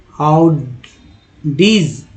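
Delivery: "हाउडीज" stretched very slowly; slow pronunciation demo. Shown as a demonstration. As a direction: draw out "हाउडीज" slowly to model it. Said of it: "ठीक है, हाउडीज". ("हाउडीज" बहुत धीरे बोलकर दिखाना)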